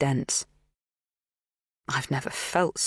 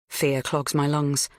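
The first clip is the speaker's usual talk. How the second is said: ordinary delivery, nothing marked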